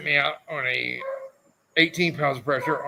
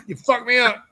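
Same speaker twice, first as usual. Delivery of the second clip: louder, higher mock yell of You fucked me up; mock angry shout; 8 semitones higher, 4 dB louder than usual. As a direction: throw the line at your friend in play anger. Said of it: "I get mad at that".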